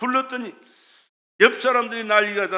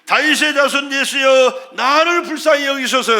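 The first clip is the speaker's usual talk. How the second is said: crying out; 4 semitones higher, 8 dB louder than usual. Retold or imitated. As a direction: bellow a desperate plea over a crowd. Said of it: "그는 소리 지릅니다".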